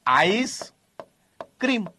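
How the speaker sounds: આઈસ ending in S, pause, ક્રીમ; split as "ice cream". Shown as a demonstration. Teacher introducing the similar food word, split આઈસ / ક્રીમ. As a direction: break after the S of આઈસ, then ક્રીમ. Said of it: "એક એના જેવો શબ્દ છે".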